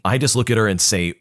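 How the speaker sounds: plain reading voice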